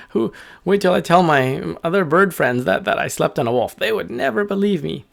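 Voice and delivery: high, giggly bird voice; high-pitched tickled bird; laughing little bird. Acted. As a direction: chatter through little laughs as an amused bird. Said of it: "And the bird laughed".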